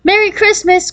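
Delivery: put-on high-pitched joyful child's cry; excited cry. A narrator's reading. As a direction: cry it out with delight. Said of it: "cried Jan".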